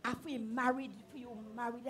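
very soft, low confiding; secretive. Acted. Friend reveals a secret love charm, very hushed. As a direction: confide it under your voice. Secretly shows a marriage potion.

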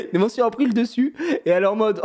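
ordinary delivery

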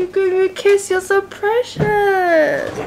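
high, drawn-out pet talk; doting coo. Real, not acted. Owner thanking dog for a kiss, doting coo. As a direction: coo slowly over an adored dog. Cooing at dog who kissed her.

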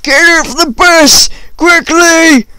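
high, loud shouted order; mock bus driver shouting at the top of his voice; panicked bus driver. Bus driver to the kids, get off the bus, urgent shout. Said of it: "the bus driver gets up and he's like".

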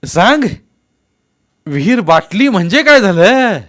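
loud, high insistence; demanding answer. A narrator's reading. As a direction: press hard for an answer. Elder demands an answer again.

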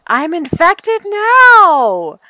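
slow, loud, high dismayed cry; alarmed wail. Real, not acted. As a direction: drag out the alarm. Just got infected.

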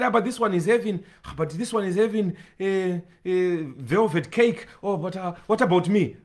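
a soft, hushed mock grumble; preacher mimicking a believer envying others' food, hushed mock whine; mock envious whine. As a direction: lightly voice a jealous diner muttering. Mocking envious churchgoer voiced.

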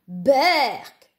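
loud yelp of disgust; disgusted cry. A narrator's reading. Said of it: "cria-t-il en bondissant".

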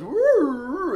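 high, loud mock "Woo"; imitating a girl's voice. Coach imitating a woman's voice on the graph, high and loud. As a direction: mimic a woman's voice leaping all over. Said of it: "it's just all over the place".

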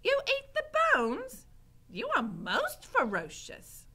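high, loud awed character voice; Mig. A narrator's reading. Mig admiring Gregory eating bones, high amazed voice. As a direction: marvel loudly, slowing at the end. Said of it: "staring at Gregory with respect".

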